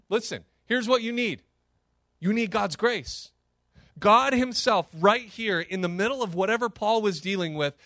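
ordinary talk, nothing marked

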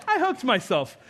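high, mock-polite announcement; hammed-up calm report; absurdly composed. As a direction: play it comically unbothered by the injury. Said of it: "It's not what I did at all".

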